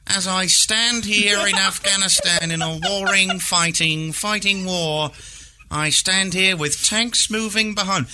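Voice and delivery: high, slow dramatic war reporter; BBC war correspondent. Retold or imitated. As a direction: report from the front line, overblown. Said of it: "That guy who does all the B B C worldwide radio reports".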